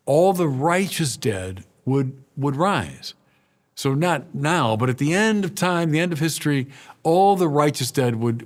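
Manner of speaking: ordinary delivery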